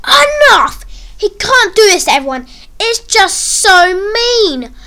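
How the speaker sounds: high, loud cry of indignation; angry outburst. Acted. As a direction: burst out, fed up, dragging out the last words. Angry inner outburst at a bully.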